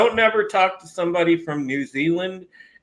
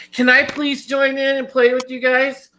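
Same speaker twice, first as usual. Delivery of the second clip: high-pitched mock fangirl voice; pleading female fans; 10 semitones higher than usual. Streamer mimicking women in chat begging to join, high mock-girly voice. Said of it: "he had fucking chicks in the chat, like".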